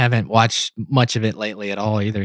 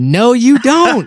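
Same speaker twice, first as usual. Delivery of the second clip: mock agreement; 10 semitones higher, 4 dB louder, much slower than usual. Echoing the mock slogan.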